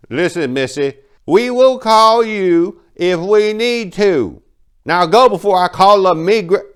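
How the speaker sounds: high, loud, slow scolding threat; irate clerk. Acted. Irate clerk threatening Maria, high and loud. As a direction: threaten her, drawn out and loud.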